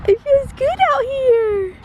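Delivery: high sing-song cooing. Real, not acted. Cooing to baby on swing.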